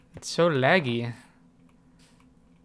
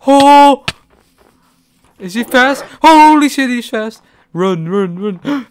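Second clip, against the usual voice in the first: high, loud panicked yelling; being chased; 9 semitones higher, 16 dB louder than usual. Real, not acted. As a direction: yell in panic while fleeing. Mob chases him in game.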